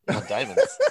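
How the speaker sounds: mock diva plea; mocking echo. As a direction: parrot the friend's demand in a silly voice. Echoing friend's demand mockingly.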